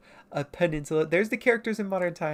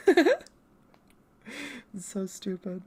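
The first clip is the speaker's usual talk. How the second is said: ordinary talk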